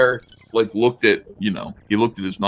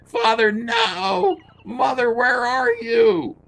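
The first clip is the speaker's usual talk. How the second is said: very high, very slow falsetto wail; overdone high-pitched cry for parents; hammed-up wail; much slower than usual. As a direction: wail it like a melodramatic heroine. Hammed-up rereading of heroine's cry.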